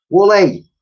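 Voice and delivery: loud high call for a lost pet; girl calling out loudly for the mammoth. A narrator's reading. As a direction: call her name across the hall. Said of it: "called out the girl".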